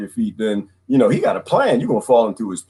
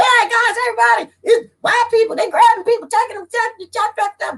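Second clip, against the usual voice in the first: high, excited alarm call to the village; alarmed warning. Retold or imitated. Kunta running back to warn everyone about the slavers, high and alarmed. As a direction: sound the alarm, voice way up.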